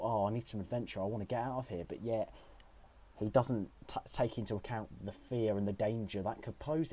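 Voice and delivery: ordinary talk, nothing marked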